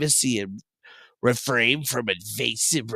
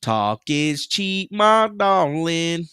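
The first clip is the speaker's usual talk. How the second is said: slow tease; 4 semitones higher, much slower than usual. Acted. Slow teasing line.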